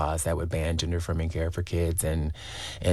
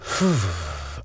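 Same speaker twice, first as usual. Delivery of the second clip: long, heavy exhale; real sigh before going on; steadying sigh. Real, not acted. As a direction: blow out a breath to gather yourself. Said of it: "trying to gather my resilience".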